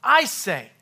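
"I" hit high and very loud, "say" falling; emphatic, loud I; stress on I. Shown as a demonstration. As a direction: punch the "I" in "I say". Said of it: "just emphasize a different word".

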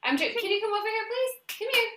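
high, quick sing-song coaxing; coaxing puppy. Real, not acted. Owner coaxing her puppy to come, high sing-song. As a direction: call the puppy over sweetly.

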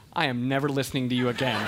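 low-pitched, deadpan verdict; imitating a daughter's firm, final word; cold confidence. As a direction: deliver it low and completely sure. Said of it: "just confident".

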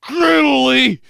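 strained, very loud rising Italy; forcing the word out in agony; pained dad. Retold or imitated. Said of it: "the pain in his face giving in".

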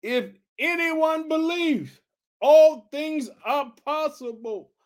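very high, slow proclamation; Jesus declaring. Retold or imitated. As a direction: stretch each word out high like a revelation. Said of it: "he said that".

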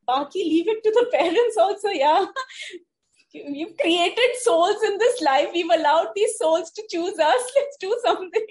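loud, high and laughing. Real, not acted. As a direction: push the point through laughter. Laughing appeal to parents.

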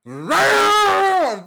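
loud high roaring engine noise; imitated revving engine roar. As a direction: roar like a car starting cold. Imitating car cold start.

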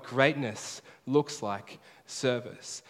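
Greatness looks like service, each word drawn out very slowly; very slow, deliberate refrain; very slow emphatic. Shown as a demonstration. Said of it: "that's the big idea".

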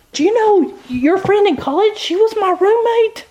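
high, eager gossip voice retelling the news; lawyer friend gossiping. Retold or imitated. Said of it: "she looks at me and she goes".

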